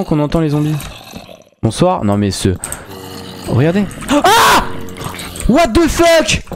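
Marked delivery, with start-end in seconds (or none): 4.1-4.6 startled scream. Real, not acted. Startled by something in game. 5.5-6.4 high, stunned exclamation; shocked. Real, not acted. Shocked reaction in game.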